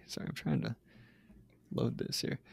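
usual speaking voice

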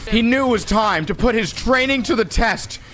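rising, high-pitched battle narration; hammed-up heroic storyteller in full cry; epic narration. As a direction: build to a shouted mock-epic climax.

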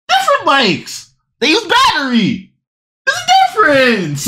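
normal talking voice

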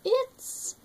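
high wolf howl; high little howl. Shown as a demonstration. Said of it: "a howl".

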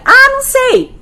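very high-pitched dismissive squeal; mock brush-off. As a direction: brush the child off, voice shot way up. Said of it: "você não vai falar assim". (desdém agudo, imitando)

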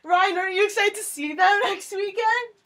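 high-pitched giddy cheer; excited. Real, not acted. Cheering friends' visit and prodding Ryan, giddy excitement. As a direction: squeal the yay, keep it high and eager. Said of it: "I'm so excited".

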